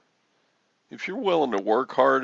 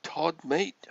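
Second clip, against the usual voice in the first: higher-pitched put-on Irish accent; attempted Irish accent; 5 semitones higher than usual. Greets Todd as mate, attempted Irish accent. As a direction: have a go at a matey Irish accent. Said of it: "I've lost my Irish accent".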